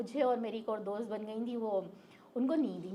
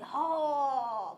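harsh scary voice; much slower than usual. Retold or imitated. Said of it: "इतनी करख्त आवाज़ थी और इतनी डरावनी आवाज़".

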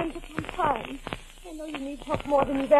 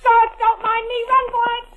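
high, loud pained cry; wounded wife urging him to flee; 10 semitones higher, 7 dB louder, slower than usual. Acted. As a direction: gasp it out through the wound. Shot in shoulder, urging escape.

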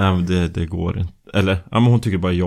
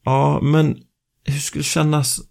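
coaxing; 7 semitones higher, slower than usual. Retold or imitated. Sweet-talking the saleswoman.

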